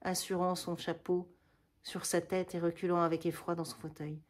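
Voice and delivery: usual speaking voice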